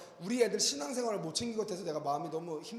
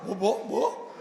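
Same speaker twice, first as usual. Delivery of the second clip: stunned what; 4 dB louder, much slower than usual. Retold or imitated. Father's shocked reply to son.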